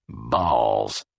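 a low, sour dismissal; a touch of sour contempt. A narrator's reading. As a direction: spit it out sourly. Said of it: "Luckman said sourly".

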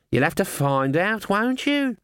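teasing bird voice, rising high; raspy cawing crow. Acted. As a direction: tease like a smug raven. Raven teasing.

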